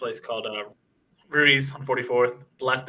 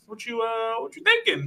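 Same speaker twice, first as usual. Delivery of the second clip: high, sly question; playful curiosity; 9 semitones higher than usual. Retold or imitated. Retelling his own coy reply to the dealer. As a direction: tease it out with rising pitch.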